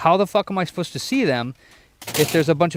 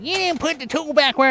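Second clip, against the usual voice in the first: much higher, put-on nagging father; nagging dad; 13 semitones higher than usual. Imitating his dad's nag about the tool, in a high mock voice. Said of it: "my dad's like".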